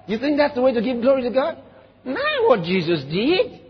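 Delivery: ordinary talk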